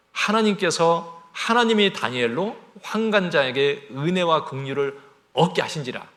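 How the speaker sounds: loud, slow emphatic proclamation; preaching at full force. Acted. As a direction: thunder it from the pulpit. Preacher proclaiming God's grace.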